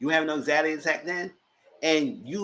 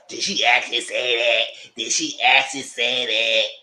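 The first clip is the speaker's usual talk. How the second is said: slow, drawn-out fake-innocent question; mock innocent; 3 semitones lower, 4 dB louder, slower than usual. Vlogger imitating the fake victim at the reunion, drawn out. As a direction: lightly play the wide-eyed victim.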